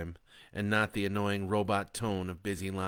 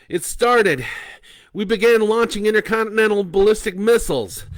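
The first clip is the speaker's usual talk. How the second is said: loud, higher, urgent announcement; 14 semitones higher, 15 dB louder than usual. Acted. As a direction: Bo telling Leah the war began, loud and urgent. Bo explains the launch.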